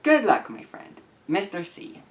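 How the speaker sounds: ordinary delivery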